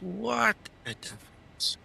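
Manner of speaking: high drawn-out voice. Pretend. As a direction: stretch it out in a high put-on voice. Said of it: "repeats phrase in new voice".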